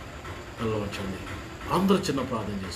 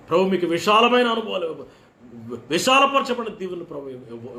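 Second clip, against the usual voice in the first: fervent preaching; 7 semitones higher, 6 dB louder than usual. Acted. Preacher rising in fervour.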